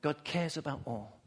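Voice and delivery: low, soft preaching with long pauses; hushed awe. Real, not acted. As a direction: let each phrase drop into a hush. Preacher drops to hushed climax.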